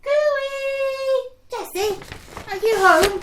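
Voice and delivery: high, loud call from outside. Acted. Friend calling to Jessie from the door, loud. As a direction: call her from the doorstep.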